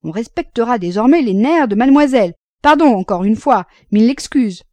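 loud, high, sarcastic deference; mock apology. A narrator's reading. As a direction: pile on sarcastic apologies to needle them. Mock apology ends the teasing. (excuses railleuses, appuyées et sonores)

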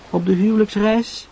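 put-on high, questioning young woman's voice; murmuring Sarah. A narrator's reading. Sarah asks her friend about the new couple, murmured question. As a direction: ask it lightly, pitched high. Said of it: "mompelde Sarah zacht".

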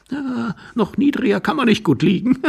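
high, jeering quip ending in laughter; mock him, then chuckle; amused high-voiced taunt with a laugh. Stranger mocks his humiliation.

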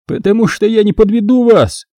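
high, insistent exclamation; exclaiming. A narrator's reading. Thief promising he won't fail them, exclaiming. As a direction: blurt a promise, pitch jumping up. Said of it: "воскликнул Дэн".

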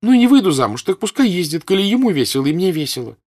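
high, loud, carefree insistence; defiant girl. Acted. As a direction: daughter brushing off her mother. Daughter argues with her mother.

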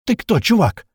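high, loud demand; losing patience. A narrator's reading. Narrator grabs the radio from April, impatient outburst. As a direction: burst out with it. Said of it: "не выдержав, спросил я в рацию".

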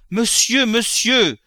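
high, loud cry of alarm. A narrator's reading. Mother sitting up in bed to her husband, crying out. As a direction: cry out sharply to stop him. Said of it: "cria la mère".

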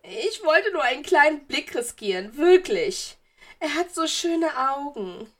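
put-on high, coy ghost girl; schwärmendes Geistermädchen. A narrator's reading. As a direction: confess coyly, then swoon over him. Narrator voices smitten ghost girl. (hoch und verliebt schwärmend)

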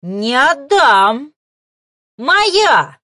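slow, loud monstrous roar; roaring darkness. A narrator's reading. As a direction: roar like a possessive beast. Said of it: "неожиданно взревела она".

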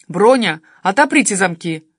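loud, commanding neighbour; giving orders. A narrator's reading. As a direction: issue a firm, loud order. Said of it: "распорядился сосед".

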